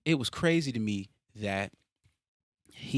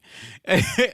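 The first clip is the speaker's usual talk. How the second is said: laugh; 12 semitones higher, 10 dB louder than usual. Real, not acted. Laughing at own video mistake.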